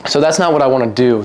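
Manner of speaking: ordinary talk, nothing marked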